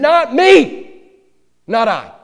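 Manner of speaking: high, loud proud cry; vehement passion. Acted. Peter proudly denying to the Lord, loud and high. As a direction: fling the denial out with pride. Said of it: "said it with vehement passion".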